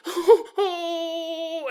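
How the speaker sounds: high whimpering moan; mock frightened wail. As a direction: imitate a distressed wail, held out. Acting the frightened dying mother.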